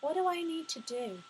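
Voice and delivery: high, lilting questions; asking life. Shown as a demonstration. Woman asks life what it needs from her today, high and entreating. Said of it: "And I ask".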